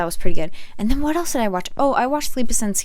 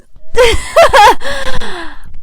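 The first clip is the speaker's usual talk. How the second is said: high loud laugh; 8 dB louder than usual. Real, not acted. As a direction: burst out laughing. Laughing burst.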